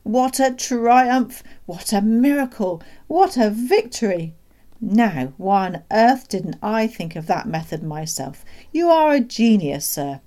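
exultant praise. Acted. Doctor hailing the boy as champion, exultant. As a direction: hail a hero with grand delight.

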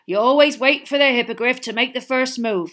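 big booming hearty giant; a jolly loud gamekeeper; Hagrid voice. A narrator's reading.

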